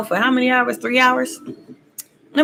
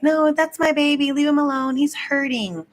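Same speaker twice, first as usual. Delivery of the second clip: protective mom; 8 semitones higher than usual. Retold or imitated. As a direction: coo it like a mother shielding her baby. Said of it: "the mom gonna be like".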